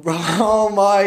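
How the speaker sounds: ordinary talk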